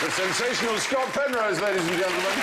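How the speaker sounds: lightly hammed-up compere intro; showman announcement. Host introduces the magician to the audience, mildly showy. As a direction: present him like a circus act.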